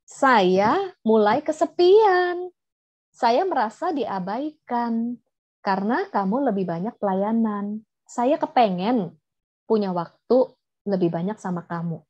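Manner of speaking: slow, measured heartfelt statement; asertif. Shown as a demonstration. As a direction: state your feelings to a husband slowly, word by word. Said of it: "Tapi kalau asertif". (mengungkapkan perasaan dengan pelan dan tegas)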